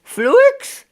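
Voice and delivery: high, loud rising call of Fluix; street seller's cry. Retold or imitated. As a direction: call out Fluix like a fish hawker up the back entry. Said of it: "they're shouting".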